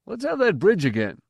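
eager man. A narrator's reading. Man calling for the ballad.

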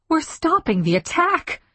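high-pitched startled realisation; sudden disbelief, pitched well up; startled thought. A narrator's reading. Surprised thought amid the attack.

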